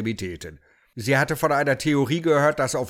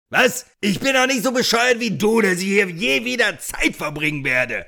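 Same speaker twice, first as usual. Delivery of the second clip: high, loud outraged shout; angry shout; 9 semitones higher, 5 dB louder than usual. Acted. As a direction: yell it in disbelief, then fume. Holmes yells at host. (empörter, hoher, lauter Ausruf)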